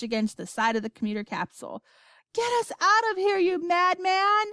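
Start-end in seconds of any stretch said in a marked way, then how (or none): 2.3-4.5 screaming grandma. A narrator's reading. Grandma begging Wonka to escape, screaming. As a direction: scream it in panic, pitch way up. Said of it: "screamed Grandma Josephine".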